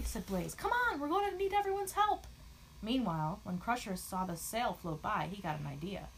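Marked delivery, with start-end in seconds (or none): 0.5-2.3 very high, excited call; rally the friends in a high voice; urgent rallying. Acted.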